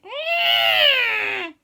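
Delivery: high, loud drawn-out screech; imitated animal noise. Andy imitating the noise he heard, high screech. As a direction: screech out a strange creature cry. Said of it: "It went".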